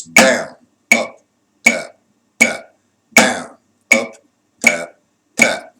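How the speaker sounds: loud single words on a steady beat; calling strokes in tempo. Shown as a demonstration. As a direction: call each stroke like a count. Calls strokes on the counted beat.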